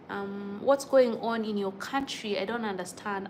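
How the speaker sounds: normal talking voice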